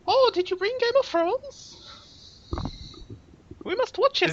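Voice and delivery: very high falsetto mock-mum voice; exaggerated, squeaky mum impersonation; imitating mum. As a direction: caricature your mother in a silly high pitch. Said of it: "is that actually how your mum sounds?".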